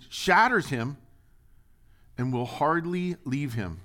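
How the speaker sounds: loud, high preaching of the father's plea; impassioned scripture reading. Acted. Preacher voicing the desperate father to the congregation, loud and pleading.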